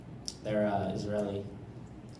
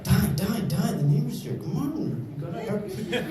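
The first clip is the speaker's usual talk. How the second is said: higher, hammed-up mom hollering; mom scolding; 10 semitones higher, 4 dB louder than usual. Comic imitating mom telling the kids to stop. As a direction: nag like a frantic mother. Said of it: "my mom's like".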